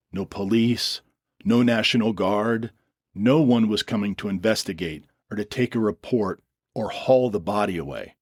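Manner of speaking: usual speaking voice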